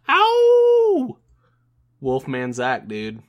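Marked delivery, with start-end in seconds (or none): 0.1-1.2 high wolf howl, loud; howling like a wolf pup. Shown as a demonstration. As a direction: let out a short high howl.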